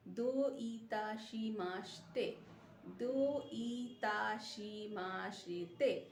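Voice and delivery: "দো ইতাশিমাস্তে" slowly, each syllable separated; careful teaching drill of "দো ইতাশিমাস্তে"; slow model pronunciation. Shown as a demonstration. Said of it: "pronunciation হচ্ছে".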